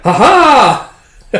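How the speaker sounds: high, loud mock triumphant laugh; gleeful laugh. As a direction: laugh like a gleeful little maniac. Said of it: "And she's like".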